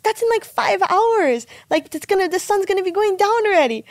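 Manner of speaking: high-pitched complaint at the wait; retold teenage dismay; dismayed protest. Retold complaint about waiting for dad.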